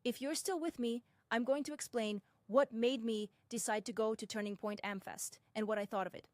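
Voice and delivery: ordinary delivery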